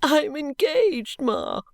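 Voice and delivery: high, slow sobbing plea. A narrator's reading. Daughter sobbing her engagement news to her mother, high and tearful. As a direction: sob it out to your mother. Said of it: "sobbed Caddy".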